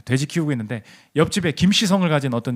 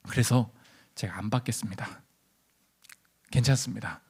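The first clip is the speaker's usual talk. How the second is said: hushed, low renunciation; acted humble refusal; 7 semitones lower, 10 dB quieter than usual. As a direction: decline softly, with quiet resolve. Believer humbly declines the compensation.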